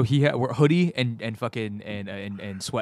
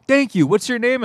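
high-pitched, louder polite imitation; Anderson Silva; 10 semitones higher, 5 dB louder than usual. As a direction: imitate a fighter's high, courteous voice. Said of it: "but in his voice".

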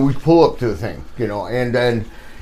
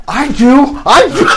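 eager mock confession; 9 semitones higher, 7 dB louder than usual. Retold eager answer.

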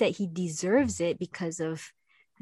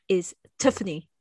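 lightly imitated South African accent; a hint of a South African Tiffany. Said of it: "with his South African accent".

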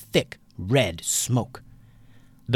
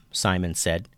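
usual speaking voice

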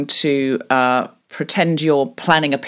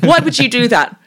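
exasperated, higher-pitched outrage; blocking partner; 6 semitones higher than usual. Shown as a demonstration. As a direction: kill their picnic idea with indignation. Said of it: "the other one will go".

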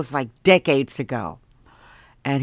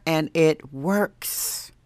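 whispered aside; 2 semitones lower, 6 dB quieter, much slower than usual. Real, not acted. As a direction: drop to a whisper.

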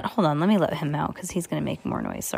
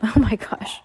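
laughing it out; 4 semitones higher than usual. Real, not acted. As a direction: giggle through it. Laughing at swear.

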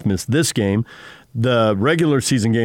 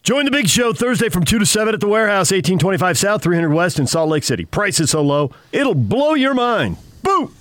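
high, punchy pitchman ad read; hard sell ad; 9 semitones higher than usual. Acted. Live ad read on the show.

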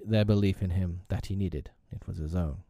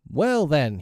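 high, bold announcement; crying out; 7 semitones higher, 4 dB louder than usual. A narrator's reading. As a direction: call it out boldly. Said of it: "he cried".

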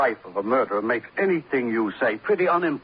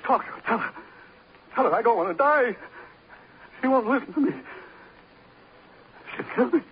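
high-pitched, desperate begging that climbs to hysteria; panicked pleading; 12 semitones higher than usual. Acted. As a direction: beg frantically for your life, voice shooting up. Begging for life at gunpoint.

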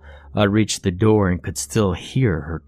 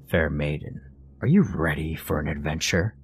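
lower, softer gallant knight; playful knight voice; 4 semitones lower, 4 dB quieter than usual. Acted. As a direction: play the noble knight to your little girl. Father playing knight for daughter.

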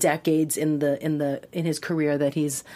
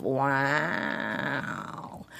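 deadpan catchphrase; 4 semitones lower, 7 dB quieter than usual. Retold or imitated. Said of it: "to have this catchphrase of".

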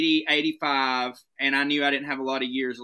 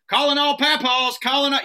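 higher, louder radio call; imagined police calling for old-man suspects; mock police dispatcher; 10 semitones higher, 5 dB louder than usual. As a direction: announce it like a cop dispatcher. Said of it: "and be like".

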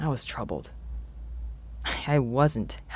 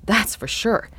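plain reading voice

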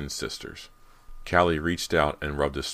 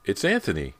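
higher, louder burst; excited exclaim; 6 semitones higher, 5 dB louder than usual. A narrator's reading. Callie exclaims at the doorbell, higher and louder. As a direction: announce him with a thrill. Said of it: "Callie exclaimed".

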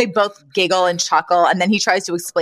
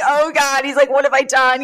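mock anguish, high-pitched and louder; very upset; 6 semitones higher, 3 dB louder than usual. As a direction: wail it like a man wringing his hands. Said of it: "He's so upset".

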